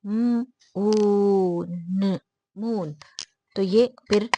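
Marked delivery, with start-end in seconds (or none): 0.1-3.0 'मून' split into held sounds म, ऊ, न; sounding out. Shown as a demonstration. Teacher breaking 'मून' into its sounds, sounded out slowly. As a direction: stretch the long ऊ, then blend into 'मून'. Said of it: "अभी अगर हम इसको देखें तो ये".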